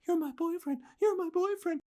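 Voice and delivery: high-pitched mock girl, repeating herself; hiding girl voice. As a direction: imitate a panicky girl under the covers. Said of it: "hiding under my cover saying".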